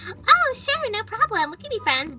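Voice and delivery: chirpy friendly agreement; sweet-voiced friend; sweet Midge voice. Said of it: "if Midge was here, she would've said".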